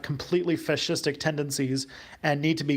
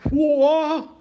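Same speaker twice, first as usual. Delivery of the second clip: hammed-up cartoon holler, much higher and louder; Hank Hill; 13 semitones higher, 9 dB louder than usual. Streamer answers a viewer's impression request, loud high cartoon yelp. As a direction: yell a startled cartoon-dad whoa. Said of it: "You do a really awesome Hank Hill impression".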